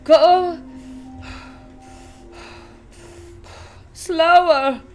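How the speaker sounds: loud, breathless protest; a puffing mother begs the guide to slow down; cry out. Acted. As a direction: call after him, lagging behind. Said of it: "cried Missus Salt".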